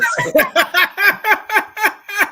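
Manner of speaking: loud, high burst of real laughter; big laugh. Real, not acted. Host cracks up telling guests about fricassee, loud high laugh. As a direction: crack up at your own story. Laughing at his own clueless story.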